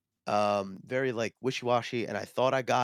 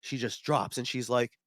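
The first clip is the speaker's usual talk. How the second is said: ordinary delivery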